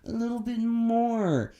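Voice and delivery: high, slow whiny voice; a put-on needy whimper; whiny entitled mock. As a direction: whine it like a spoiled passenger. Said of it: "It's always gotta be like".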